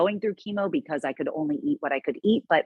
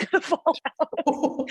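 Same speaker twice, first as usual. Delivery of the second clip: words breaking up into laughter; laughing; 11 semitones higher, slower than usual. Real, not acted. Cancer survivor joking about her port falling out, laughing. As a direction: laugh through the end of the sentence.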